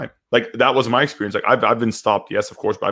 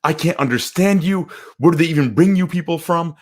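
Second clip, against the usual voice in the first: angry caller; 7 semitones higher than usual. Retold or imitated. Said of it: "somebody would just go off".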